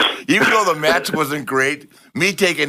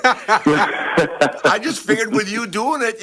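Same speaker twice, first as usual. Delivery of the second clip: ordinary talk, nothing marked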